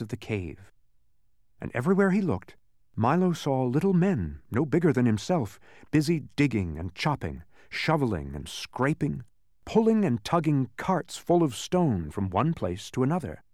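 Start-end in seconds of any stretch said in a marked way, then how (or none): none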